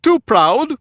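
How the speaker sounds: very high, loud, taunting question; mocking query. A narrator's reading. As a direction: needle her with it. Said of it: "the other inquired".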